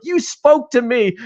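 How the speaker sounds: high, slow, swooning reading of a bad novel; mock gushing reader. Writer listens to rival author read her book, mock swooning. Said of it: "he's listening to her, like, read this fucking book".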